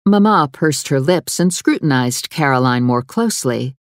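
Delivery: plain reading voice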